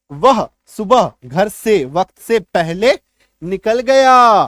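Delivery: teacher reading an example slowly; slow example sentence. Shown as a demonstration. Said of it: "example sentence".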